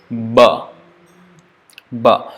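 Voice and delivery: "Ba" voiced from deep in the throat; teacher models a low "Ba". Shown as a demonstration. Teacher demonstrating Sanskrit ba, low in throat. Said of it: "with the deeper part of the throat".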